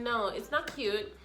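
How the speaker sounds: ordinary talk, nothing marked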